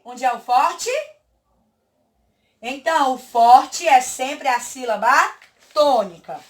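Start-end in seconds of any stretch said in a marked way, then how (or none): none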